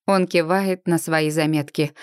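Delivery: usual speaking voice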